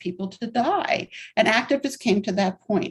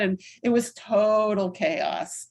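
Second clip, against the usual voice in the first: ordinary talk, nothing marked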